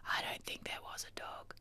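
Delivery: hushed, voiceless mock-secret whisper; imitating sceptics in a very soft whisper; conspiratorial whisper. Mimicking texters' conspiracy theories.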